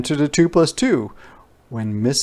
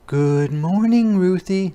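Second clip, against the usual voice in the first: higher, slow, sing-song teacher greeting; lightly voiced kind teacher; teacher's voice; 9 semitones higher, 4 dB louder, slower than usual. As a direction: greet the child like a friendly teacher. Teacher greets Ruthie in story.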